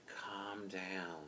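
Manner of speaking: very soft, low, soothing aside; hushed near-whisper. Retold or imitated. Officer at the driver's side, whispering to soothe. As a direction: imitate him quietly calming someone. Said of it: "whispering to me".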